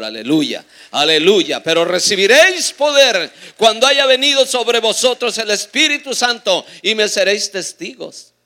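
loud, high, fervent preacher's cry; impassioned pulpit shout; full-force preaching. Real, not acted. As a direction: proclaim it to rouse the congregation.

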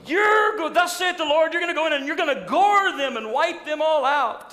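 overexcited false prophet shouting victory; boastful prophet. Retold or imitated. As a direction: crow the prophecy like a cheerleader. Zedekiah boasting with iron horns.

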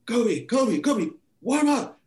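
high called-out name, slow on warm up; calling out. Retold or imitated. Assistant calling the sub to warm up, high call. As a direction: call him over from across the bench. Staff calling him from bench.

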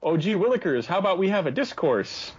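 lightly voiced gee-whiz boy; a hint of an eager innocent kid; wholesome 1950s kid. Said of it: "you're gonna be like".

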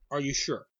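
snippy, doubting sneer; attitude. Retold or imitated. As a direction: needle them with a snotty tone. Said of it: "can't you tell the attitude in my voice".

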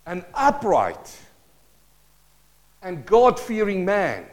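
high, drawn-out preacher's declamation; scripture proclamation. Acted. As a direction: proclaim each title grandly, stretching the words. Proclaims the men's praise from scripture.

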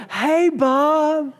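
high, bright put-on church smile; sudden syrupy high-pitched hello after the fight; fake cheerful greeting. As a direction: switch to a sugary high voice for show. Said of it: "you get out of the car and you go".

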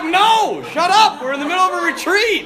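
high-pitched mock telling-off; mock exasperated. Priest tells off noisy interrupters mid-retreat, mock exasperated. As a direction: scold the noisemakers in joking protest.

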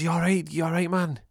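high, loud, urgent questioning; frantic concern. Acted. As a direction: check on a mate in a panic. Acted frantic check after shooting.

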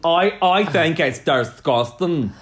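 slow, loud, put-on accent; hammed-up self-parody of disapproval; mock disgust. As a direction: stretch "disgusting" with odd vowels. Exaggerates own verdict in put-on voice.

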